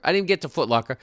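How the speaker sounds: ordinary talk, nothing marked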